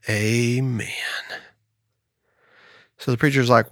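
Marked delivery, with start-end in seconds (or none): 0.1-0.9 a quiet, understated amen; hushed amen. Acted. Farmer reveals the quiet stop word.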